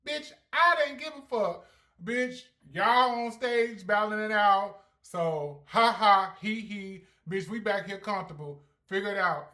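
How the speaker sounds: loud, high-pitched sassy rant; brash dismissal. Retold or imitated. Vlogger recapping a battle on stage, brash and dismissive. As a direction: throw it out loudly, without a care.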